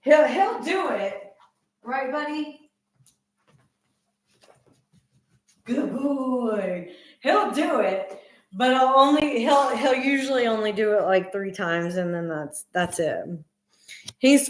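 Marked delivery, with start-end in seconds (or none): 0.0-8.2 pet talk. Real, not acted. Talking sweetly to her dog.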